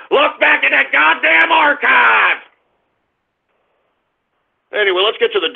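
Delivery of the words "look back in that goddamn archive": a host yelling at his doubters; taunting shout. Acted. Repeats the challenge as a shout.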